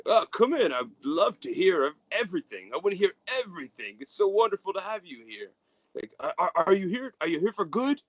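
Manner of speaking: high, merry fatherly gush; jolly father. Acted. The merry father welcoming his son home, high and gushing. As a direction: beam over the boy like a proud dad.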